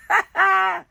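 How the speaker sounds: sudden high laughing yelp as the gem flies off; a burst of laughter at the mishap; startled laugh. Real, not acted. Crafter laughing as her gem falls off.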